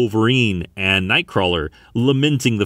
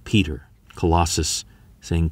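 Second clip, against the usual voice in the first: plain reading voice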